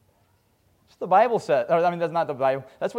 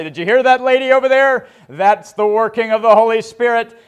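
loud, high, thrilled proclamation; much higher and louder triumphant cry; excited preacher shout; 6 semitones higher, 11 dB louder than usual. Retold or imitated. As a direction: proclaim it like a revival preacher calling out the crowd. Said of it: "and he'd say".

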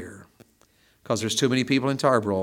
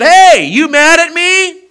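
calling out; 17 dB louder, slower than usual. Retold or imitated. Pastor calls to man at door.

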